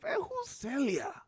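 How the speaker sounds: put-on a boy who never heard of Celia; puzzled kid. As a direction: ask it like a clueless child. Child confused, retold.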